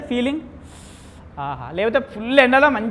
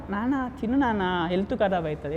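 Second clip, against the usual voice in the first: caring and cool; 2 semitones lower, 8 dB quieter than usual. Retold or imitated. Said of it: "ఎంత cool మాట్లాడతారని".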